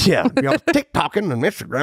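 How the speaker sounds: mock old dad saying TikToking; hammed-up out-of-touch dad. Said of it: "Sound like my pops".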